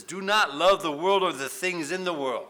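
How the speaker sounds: verse read out in a loud, high preaching voice; preached verse. Acted. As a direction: proclaim the verse at full volume. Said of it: "It says".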